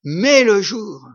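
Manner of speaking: swelling pulpit delivery; fervent preaching. Acted. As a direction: preach it with full force to the congregation. Preaching at full force.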